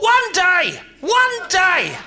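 high, shouted dreamy boast; childhood dream voice. Retold or imitated. Comic voicing his younger self, excitedly dreaming. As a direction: dream big like a hopeful kid. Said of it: "I thought".

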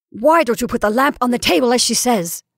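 loud, fierce demand; almost fierce. A narrator's reading. Caroline backing her sister about the lamp, almost fiercely. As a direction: push the question out hard, nearly fierce. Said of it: "almost fiercely".

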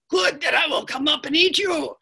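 high, loud mock threat; menacing bridge voice. Bridge voice threatening littlest goat, loud and high. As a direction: threaten loudly like a storybook troll.

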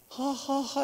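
high, put-on ha-ha-ha; imitated helpless laughter. Man who cannot stop laughing, mimed high laugh. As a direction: act out laughing against your will. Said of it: "I cannot stop laughing".

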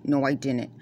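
normal talking voice

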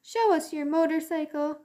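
high, squeaky little cousins; squeaky kids. Acted. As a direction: squeak like eager little mice. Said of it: "they squeaked".

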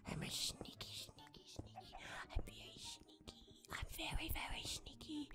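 playful unvoiced whisper; sneaky whisper. Gamer sneaking on another player, playful whisper. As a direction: whisper it like a kid creeping up.

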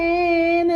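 drawn-out pretend wail; imitated baby cry. Showing the child how the baby cried, mock wail. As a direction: mimic a baby crying, stretched out. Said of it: "എങ്ങനെ കരഞ്ഞ?".

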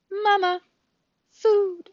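put-on high baby bird cry; tiny high-pitched chick voice; gosling. A narrator's reading. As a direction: squeak it like a hungry hatchling. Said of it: "said the gosling".

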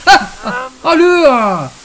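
high, loud frightened cries; panicked cries. Real, not acted. Player panicking at the monster, crying out. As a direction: gasp and cry out in fear. Another scare.

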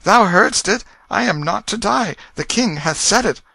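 high, loud jubilation; joyful exclamation. A narrator's reading. Tom telling the lords he is to live, joyful exclamation. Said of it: "and exclaimed".

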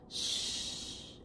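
unvoiced shh; hushing. A narrator's reading. Aunt hushing the child on the dark porch, whispered. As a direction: hush softly in the dark. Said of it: "Aunt Louisa whispers".

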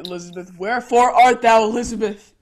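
loud, theatrical lovesick declamation; mock-Shakespearean declaiming. Lovesick teen misquoting Juliet for Elizabeth, loudly declaimed. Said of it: "That's Juliet's line, you idiot".

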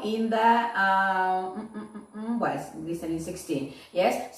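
usual speaking voice

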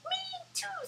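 very high tiny mouse squeak; squeaky mouse. Acted. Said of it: "squeaked the mouse".

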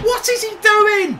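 loud exasperated question; angry. Real, not acted. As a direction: demand an answer in outrage. Disbelief at the player.

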